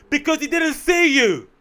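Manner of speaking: loud, high, drawn-out retort; exasperated mock shout. Comic answering pensioner knocked by passer-by, exasperated shout. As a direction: yell it slowly in comic exasperation.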